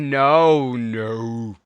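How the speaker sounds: loud, long wailed no; hammed-up cry of grief; mock dismay. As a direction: belt out a drawn-out, mock-horrified no. Mock dismay at character's death.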